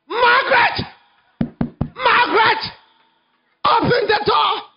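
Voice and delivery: desperate shouting. Retold or imitated. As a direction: pound on the door with your voice, begging. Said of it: "he started shouting".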